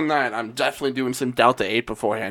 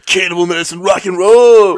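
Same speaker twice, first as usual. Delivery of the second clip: playful metal-show holler, louder on "roll"; high-energy rock shout; 4 semitones higher, 10 dB louder than usual. Pretend. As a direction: belt it out like a rock frontman. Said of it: "bringing the energy for that one".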